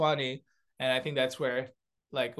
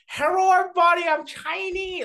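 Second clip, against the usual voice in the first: much higher, louder, slowed mock Chinese accent; bad Chinese accent impression; 13 semitones higher, 6 dB louder than usual. Comic quoting Dave Chappelle's bad Chinese accent, much higher and slowed. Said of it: "Dave Chappelle's, like, typical Chinese".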